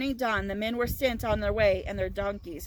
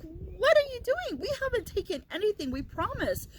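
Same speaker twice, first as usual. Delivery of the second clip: high, pleading protest from the accused brothers; panicked brothers; 8 semitones higher than usual. Retold or imitated. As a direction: squeak out the denial like scared men caught out. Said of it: "brothers are like".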